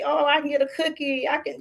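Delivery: put-on excited kid. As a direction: lightly voice a kid thrilled by a cookie.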